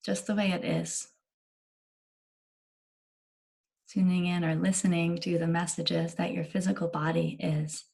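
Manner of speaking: unhurried guiding voice with long pauses between phrases; calm meditation leader, letting each phrase settle; guided meditation. Acted. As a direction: gently guide listeners into body awareness, leaving lots of space.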